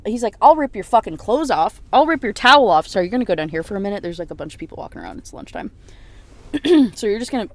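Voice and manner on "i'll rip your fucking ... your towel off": loud, punched retold threat; acted anger, hard and loud; angry threat. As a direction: bark each word as a threat. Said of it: "he's getting more and more angry".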